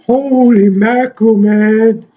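high, loud gasp of wonder; amazed. Real, not acted. Collector marvelling at the huge King Kong figure, amazed.

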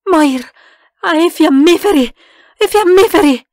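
loud, high, frantic call; alarmed cry for help; panicked shout. A narrator's reading. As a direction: call out urgently in the dark. Panicked cries in darkness.

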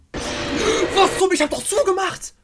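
high, loud indignant protest; shrill outraged yelling at the game; outraged disbelief. Real, not acted. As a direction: cry out that it's unfair. Outraged at jumpscare.

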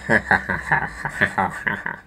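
soft staccato villain laugh; forced evil ha-ha-ha; angry laugh. A narrator's reading. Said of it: "Snape laughed angrily".

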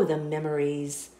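ordinary delivery

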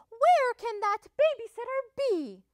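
high, loud fussy mother hen; fussing. A narrator's reading. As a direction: fret aloud, high-pitched. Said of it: "she fussed".

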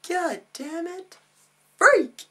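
high, drawn-out curse ending in a yell; hammed-up high-pitched exasperation; mock outburst. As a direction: squeeze it high, then shriek the last word. Mock argument with himself.

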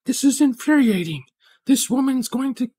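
hammed-up high-pitched exasperation; exasperated Professor Higgins. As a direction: play a professor at the end of his rope. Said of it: "and he was like".

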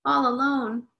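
a hint of a crying little child. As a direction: whimper like a sulking child. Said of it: "starts to cry".

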